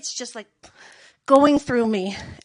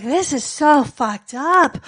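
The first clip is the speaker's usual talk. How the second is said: slow dismay; 4 dB louder, much slower than usual. Retold or imitated. Dismayed reaction.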